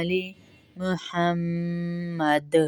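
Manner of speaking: slow محمد with the final dal bounced; qalqala on dal. Shown as a demonstration. Teacher shows the qalqala on dal. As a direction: let the dal of محمد echo. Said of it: "دال پہ کلکلا کریں گے".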